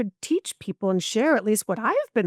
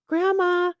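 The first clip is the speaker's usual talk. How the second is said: put-on higher, louder, a little kid calling down the hall; child calling grandma; 10 semitones higher, 4 dB louder than usual. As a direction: call her name like a small child.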